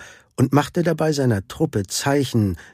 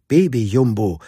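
ordinary delivery

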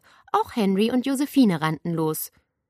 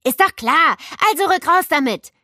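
louder, pushy and impatient; 10 semitones higher, 9 dB louder than usual. A narrator's reading. Lukas urging Nele to spill the secret, impatient. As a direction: hurry her up. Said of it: "Lukas hob ungeduldig zwei Finger".

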